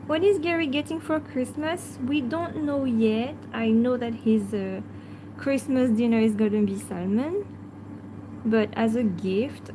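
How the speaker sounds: ordinary delivery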